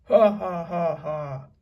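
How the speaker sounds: loud, nasty pirate cackle; mean ugly laugh. A narrator's reading. Pirate jeering at Jack, mean ugly laugh. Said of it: "laughed a mean, ugly laugh".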